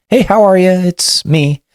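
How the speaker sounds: high, bright greeting of a woman; cheerful caseworker. Retold or imitated. Caseworker greeting her client on the phone, high cheerful voice. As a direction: mildly imitate her upbeat hello. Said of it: "And she says".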